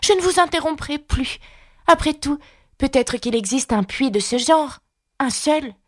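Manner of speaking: fillette à la voix aiguë qui s'excuse; Alice conciliante. A narrator's reading. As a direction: promettre de ne plus interrompre. Alice promet. (fillette conciliante, plus aiguë)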